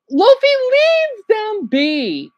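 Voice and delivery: high, drawn-out exasperated cry; exasperated plea. Real, not acted. Viewer calling at Luffy over a family, exasperated. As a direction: stretch out the plea in a high pitch. Scolding streamer on screen.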